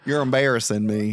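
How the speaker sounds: put-on a sulky teen, pitched low; mock teen voice. Teen son whining at his dad, in a low put-on voice. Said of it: "I didn't know who you were".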